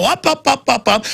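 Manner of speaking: punchy, high-pitched repeats; escalating repetition. Real, not acted. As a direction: fire off each "up" high and quick. Mimics figures climbing ever upward.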